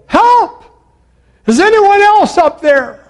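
very high, loud holler; desperate yell. Acted. Jack rejecting God's advice, yelling for someone else. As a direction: yell past the one who answered. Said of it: "and finally Jack yelled".

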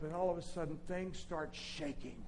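usual speaking voice

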